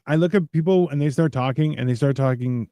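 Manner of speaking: usual speaking voice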